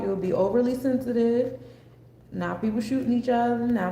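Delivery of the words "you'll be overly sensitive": ordinary talk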